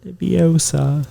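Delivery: slow mock British spell; drawn-out fake British Leviosa; attempted British. Friend asked to speak British, slow mock British accent. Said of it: "Try, try speak British".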